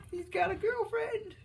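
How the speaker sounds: high, drawn-out squeal of an excited mom; mock freak-out. Mom thrilled her son has a girlfriend, mock freak-out. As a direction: stretch it out way up high, imitating a gushing mom. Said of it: "his mom's freaking out".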